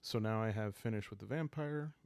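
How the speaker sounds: ordinary talk, nothing marked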